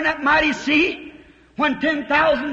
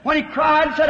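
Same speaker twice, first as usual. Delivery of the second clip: usual speaking voice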